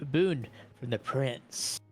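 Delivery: usual speaking voice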